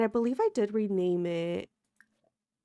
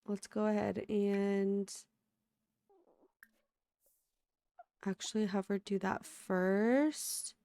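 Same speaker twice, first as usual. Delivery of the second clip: sleepy voice; 5 dB quieter, slower than usual. Real, not acted. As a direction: sound freshly woken up, sleepy. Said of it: "my sleepy voice".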